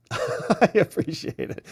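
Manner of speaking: thanks broken up by laughter; laughing. Real, not acted. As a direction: laugh your way through the thanks. Laughs while thanking a donor.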